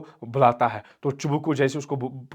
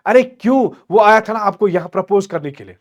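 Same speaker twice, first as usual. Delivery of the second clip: higher, louder, exasperated reply; agitated father; 7 semitones higher, 9 dB louder than usual. Acted. Father explaining the proposal to daughter, agitated. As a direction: throw it back emphatically, high and loud.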